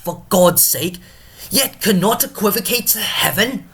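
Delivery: high-pitched declaiming that builds in force; Drunken Porter. Acted. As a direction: rant theatrically like a tipsy gatekeeper. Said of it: "let us invite Drunken Porter himself".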